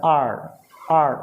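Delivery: आर with a curled tongue; curled आर sound; rolled r. Shown as a demonstration. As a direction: roll the tongue on आर twice. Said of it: "rolling आता है".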